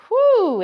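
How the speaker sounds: a big relieved whew, way up high; loud high whew. Real, not acted. Instructor to class after the set, loud relieved whew. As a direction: blow it out like the set just ended.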